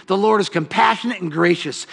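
ordinary talk

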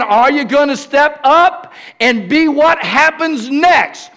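high, loud shouted preaching, slowing on key lines; preaching at full force. Acted. Pastor calling church leaders to rise up, shouted preaching. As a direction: whip the room up to rise.

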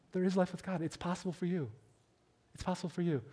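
hushed, earnest repetition; softly sincere encouragement; tender reassurance. Real, not acted. Preacher tenderly reassures the congregation.

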